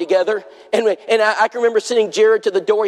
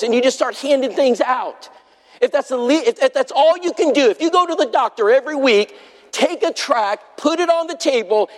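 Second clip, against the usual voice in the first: preaching; 4 semitones higher than usual. Acted. Preacher exhorting his congregation.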